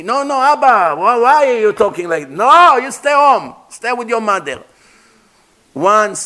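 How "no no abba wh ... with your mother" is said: pleading son. Retold or imitated.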